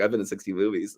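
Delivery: softly laughing through it, pleased with himself; a proud kid's giggly boast; proud little boy. Retold or imitated. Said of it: "little boy with a smile, like he's really proud".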